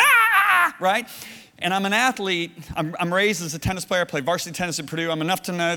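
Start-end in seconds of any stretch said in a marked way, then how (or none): 0.0-0.8 loud, high, held yell; imitated pain scream. Preacher reenacting his knee injury on the wall, loud pained scream. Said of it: "And I went".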